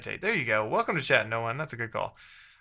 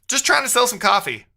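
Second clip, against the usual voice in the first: higher and louder, fed up; exasperated outburst; 9 semitones higher, 7 dB louder than usual. Real, not acted. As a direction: blurt it out in frustration. Frustrated outburst at broken build.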